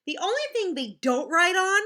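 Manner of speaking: ordinary delivery, nothing marked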